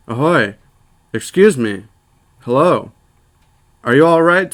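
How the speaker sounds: loud hail across the water, rising on the last call. A narrator's reading. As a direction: shout out to a motionless stranger. Calling to a distant figure.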